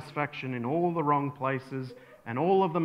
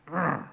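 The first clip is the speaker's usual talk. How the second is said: growl of disgust. Acted. Pharisee who can't stand your faith, growling in disgust.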